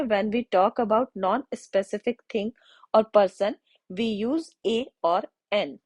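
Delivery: the rule read very slowly for note-taking; slow dictation. Shown as a demonstration. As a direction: dictate so students can write it down. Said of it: "आप ये note कर सकते हैं". (नोट करवाने के लिए बहुत धीमे)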